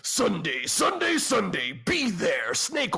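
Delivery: booming hyped announcer shout; monster truck ad. Pretend. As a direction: yell it like a monster truck rally promo. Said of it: "make commercials that sound like fucking monster truck commercial".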